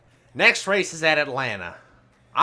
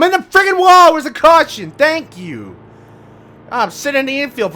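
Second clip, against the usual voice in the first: high, loud enraged yell; real rage at crashing into the wall; furious shout; 11 semitones higher, 10 dB louder than usual. Real, not acted. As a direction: blow up at the game. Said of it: "I'm so mad".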